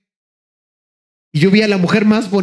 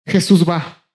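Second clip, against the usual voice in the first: normal talking voice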